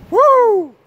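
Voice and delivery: high, loud "woo!"; MJ75's woo. Retold or imitated. Vlogger copies another creator's call after a shout-out, high whoop. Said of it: "I have to do his woo!".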